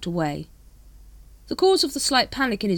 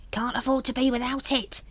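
a touch of a hissed sales pitch; mild hiss; 7 semitones higher than usual. A narrator's reading. Dibbler leans close to Vimes, lightly hissed pitch. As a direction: hawker leaning in, lightly hissed. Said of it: "he hissed".

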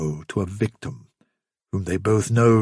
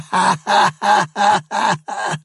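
cruel mocking laughter; 9 semitones higher than usual. Mocking creatures cackle.

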